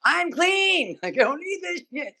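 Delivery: re-enacted squeal of innocence; high-pitched protest. Teller protests to neighbours with knives, high-pitched plea. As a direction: plead it high, wanting no part of it.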